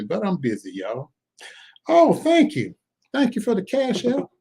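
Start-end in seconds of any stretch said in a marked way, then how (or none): 1.9-4.3 high squealed thank-you; playful falsetto gushing. Pretend. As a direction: squeal your gratitude in a tiny high voice. Thanks a viewer for a gift.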